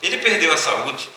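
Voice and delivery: normal talking voice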